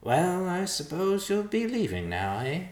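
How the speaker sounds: high-pitched sulky bird voice; sullen vulture. A narrator's reading. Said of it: "the vulture said sullenly".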